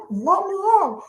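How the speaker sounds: high, pleading call. Retold or imitated. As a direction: beg through the window. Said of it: "I'm like".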